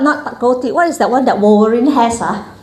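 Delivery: usual speaking voice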